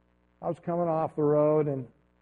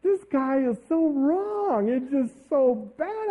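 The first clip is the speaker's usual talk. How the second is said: much higher, slow, whining about unfairness; whiny complaint; 8 semitones higher, slower than usual. Retold or imitated. Pastor retelling his sulk after the ticket, high and whiny. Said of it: "I said".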